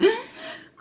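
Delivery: high, sudden real sob; crying out, high-pitched. Real, not acted. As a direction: burst into a sob. Breaking down crying during testimony.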